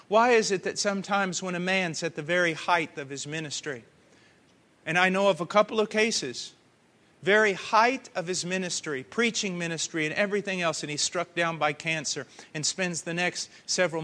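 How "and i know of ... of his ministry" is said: ordinary talk, nothing marked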